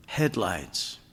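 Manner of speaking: ordinary delivery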